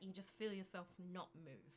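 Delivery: ordinary talk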